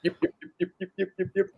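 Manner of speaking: yip. Acted. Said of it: "is all you actually hear".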